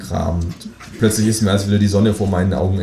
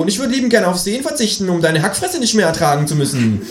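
high, fast kangaroo insult; 11 semitones higher than usual. Acted. Kangaroo trading insults with the narrator, high fast. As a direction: fire the insult back as the kangaroo. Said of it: "sagt das Känguru".

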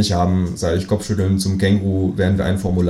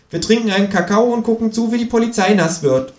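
higher, smug know-it-all kangaroo voice; cheeky kangaroo; 14 semitones higher than usual. Acted. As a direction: play the kangaroo pleased with its own cleverness. Känguru cheerfully invites to café. (frecher Känguru-Ton, höher und selbstgefällig)

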